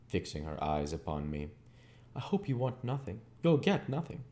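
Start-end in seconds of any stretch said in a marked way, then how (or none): 2.2-4.2 put-on higher, icy old woman's voice; cold old lady. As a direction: warn him off, coldly, rising at the end. Miss Havisham rebuffs Pip.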